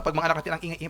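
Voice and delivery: ordinary delivery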